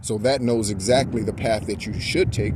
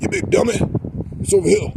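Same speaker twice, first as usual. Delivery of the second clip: much higher and louder, mock exasperated old junkman scolding; Fred Sanford; 9 semitones higher, 4 dB louder, faster than usual. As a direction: bark it like a cranky sitcom dad. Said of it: "Fred Sanford impersonation".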